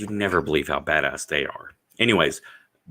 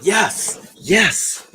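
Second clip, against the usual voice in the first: excited yes; 13 semitones higher, 5 dB louder than usual. Real, not acted. Excited agreement.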